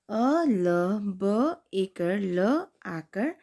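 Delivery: slow, drawn-out letter names; slow spelling. Shown as a demonstration. Teacher spelling a word for kids. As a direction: sound out each letter slowly. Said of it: "अब दूसरा शब्द है".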